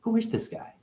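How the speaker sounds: self-mocking viewer. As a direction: play a puzzled viewer in a high voice. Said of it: "I'm dissing myself".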